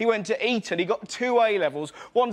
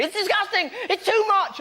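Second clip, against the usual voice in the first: high, shrill-free mock parental outrage, much higher; 12 semitones higher than usual. Comic mimicking outraged parents, much higher. Angry parents quoted in stand-up.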